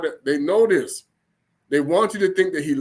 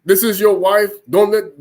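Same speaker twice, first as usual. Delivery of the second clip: scolding father; 3 semitones higher, 6 dB louder than usual. Acted. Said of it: "the father's like".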